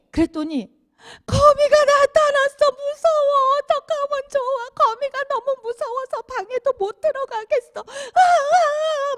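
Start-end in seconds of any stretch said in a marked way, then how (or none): 1.3-9.2 put-on high, wailing child sobbing through the words; child crying. Child too scared of a spider to enter the room, wailing high. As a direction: bawl like a terrified little kid. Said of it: "막 우는 거예요".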